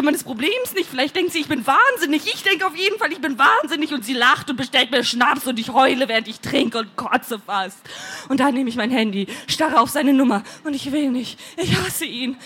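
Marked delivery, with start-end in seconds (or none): none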